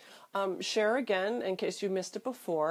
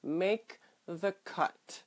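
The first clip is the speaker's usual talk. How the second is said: ordinary delivery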